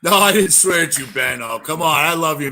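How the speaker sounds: ordinary talk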